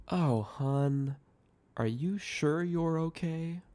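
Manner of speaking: patronizing concern, quiet and drawn out; condescending. A narrator's reading. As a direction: talk down sweetly, as if to a child. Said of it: "with a condescending tone".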